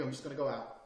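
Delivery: soft, low reassurance; calm and nice. Acted. Man leaving mid-argument with his girl, calm. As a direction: say it gently, refusing to argue. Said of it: "you being sensible and calm and nice".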